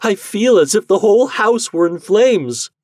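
high, loud cry of dread; distressed, high-pitched wail; anguished cry. Acted. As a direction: cry out in theatrical panic. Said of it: "cried the wife".

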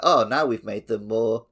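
usual speaking voice

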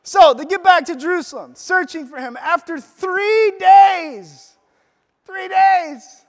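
booming sermon climax; preaching at full force. Real, not acted. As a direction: preach it out to the whole room.

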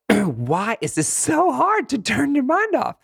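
high, slow wail of frustration; hammed-up helpless complaint; exasperated venting. Venting frustration at failed meditation.

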